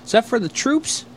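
high, naive mock question; Ray voice. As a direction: imitate a clueless kid asking. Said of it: "he goes".

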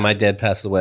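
usual speaking voice

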